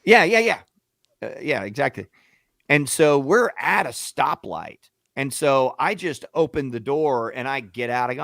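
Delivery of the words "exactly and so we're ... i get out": ordinary talk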